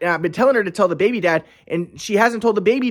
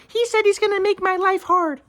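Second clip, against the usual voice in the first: higher mocking voice of the ex; mocking his ex; 12 semitones higher than usual. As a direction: tattle in her voice, pitched up. Ex reports him, voiced mockingly.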